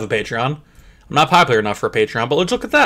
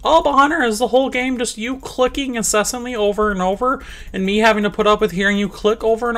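high mocking whine of a complaining viewer; whiny viewer voice; 9 semitones higher than usual. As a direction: mimic an annoyed fan. Imitating a whining viewer.

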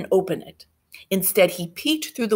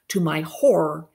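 plain reading voice

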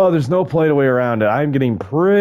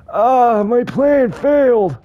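mock despair; 12 semitones higher, 4 dB louder, slower than usual. Real, not acted. Just killed by the T-Rex.

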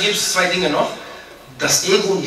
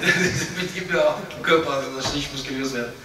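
pleading prayer through laughter; 3 semitones lower than usual. Retold or imitated. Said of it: "der hat darum gebittet".

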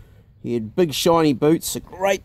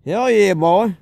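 put-on higher-pitched boastful Texan accent; pitched-up Texan accent, swaggering; 5 semitones higher, 4 dB louder, slower than usual. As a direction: brag like a Texas hog hunter, Texan accent. Imitating Chuck.

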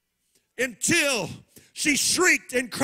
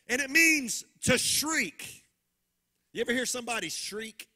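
ordinary delivery

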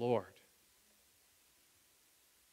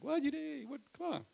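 incredulous; 11 semitones higher than usual. Retold or imitated. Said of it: "Pete's like".

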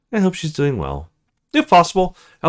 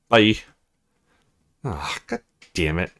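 ordinary delivery

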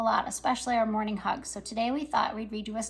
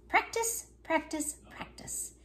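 each practice softer until it is only a whisper; fading repetition; 5 semitones higher, 6 dB quieter, slower than usual. Acted. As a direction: let the word fade away to a breath. Siblings repeat their advice.

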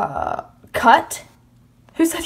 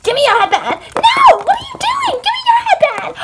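very high-pitched frantic shrieks; shrieking squabble; faster than usual. Acted. Girls fighting over a headband, shrieking demand. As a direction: screech it while grabbing.

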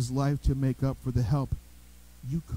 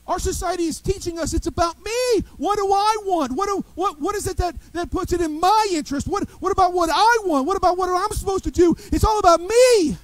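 higher and louder, building to a near shout; preaching climax; 12 semitones higher, 7 dB louder than usual. Real, not acted. Preacher denouncing selfishness to the congregation, building loud. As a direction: drive it home with rising force.

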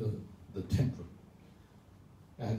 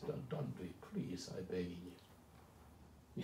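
begging plea; 6 semitones lower, 12 dB quieter than usual. Retold or imitated. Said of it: "like this".

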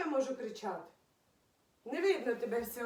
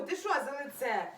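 ordinary talk

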